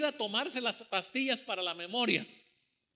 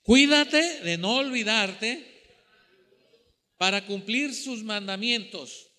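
loud, slow scripture reading, easing toward the end; strong reading voice; 7 dB louder, slower than usual. Shown as a demonstration. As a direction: lead the congregation through the verse in full voice. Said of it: "Vamos a leer con voz fuerte".

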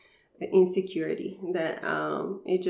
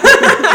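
bright burst of real laughter, much higher and louder; 19 dB louder than usual. Real, not acted.

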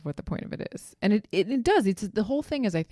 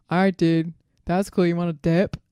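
lightly voiced dude, louder; imitated bro; 7 dB louder than usual. Guest quoting a guy asking his friend to leave, light bro impression. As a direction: play a casual bro to his buddy.

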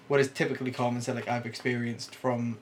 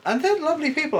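higher and louder, fond and effusive; gushing praise; 5 dB louder, faster than usual. Real, not acted. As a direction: say it with a bright lift. Praising call centre staff again.

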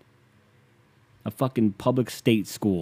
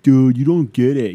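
much louder mock-outraged voice; indignant objector; 2 semitones higher, 9 dB louder than usual. Imagined critic scolding the host, mock indignant. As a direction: burst out like an offended critic. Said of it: "if the wrong people hear this".

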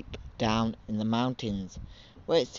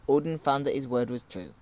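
ordinary delivery

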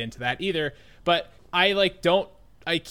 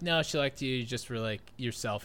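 soft, low reassuring classmate; soft classmate reassurance; 4 semitones lower, 7 dB quieter than usual. Retold or imitated. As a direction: gently reassure. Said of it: "They're like".

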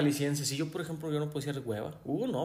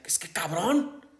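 scolding dad; slower than usual. Retold or imitated. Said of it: "mi papá me regañaba y me decía".

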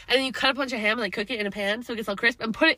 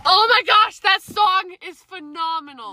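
loud, high burst of enthusiasm; gushing; 7 semitones higher, 6 dB louder, slower than usual. Real, not acted. Fan defends a song a friend hates, gushing. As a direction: gush over it, drawing out the praise.

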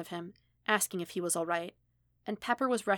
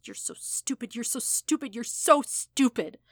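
self-hating inner voice rising from whisper to shout; 5 semitones higher, 5 dB louder than usual. Acted. Self-loathing thoughts building from whisper.

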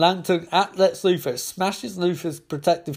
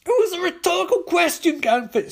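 very high-pitched shouted retort; exasperated shout; 3 dB louder than usual. Acted. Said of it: "shouts Carol".

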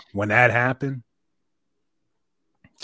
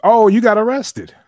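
loud, high-pitched dawning discovery; surprised realization; 12 semitones higher, 10 dB louder than usual. Real, not acted. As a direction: blurt it out as it clicks. Sudden realization about friend's arrest.

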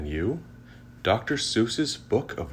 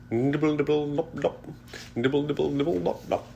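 high light pattering rain; 9 semitones higher, faster than usual. A narrator's reading. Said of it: "He can go like the rain".

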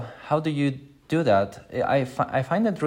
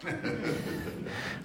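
breathy laugh; 11 semitones higher, 14 dB quieter than usual. Real, not acted. Laughs at own example.

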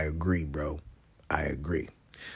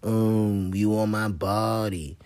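mocking voice; 3 semitones higher, much slower than usual. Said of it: "people be like".

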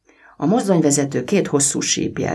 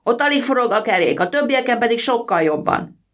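put-on boy's voice; 11 semitones higher than usual. A narrator's reading. Boy explains to driver, voiced.

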